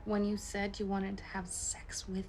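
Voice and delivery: furtive, intimate whisper; hushed whisper. Acted. Secret proposition from a meeting, whispered. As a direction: drop to a whisper so nobody overhears.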